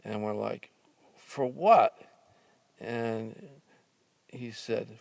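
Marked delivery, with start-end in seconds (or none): 1.1-2.0 high, loud indignant question; indignant for what. Retold or imitated. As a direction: protest in disbelief. Boys protest the charge.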